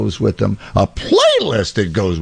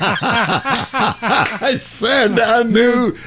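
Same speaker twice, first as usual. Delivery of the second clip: laughing through the words, stretched out; laughing quote; much slower than usual. Retold or imitated.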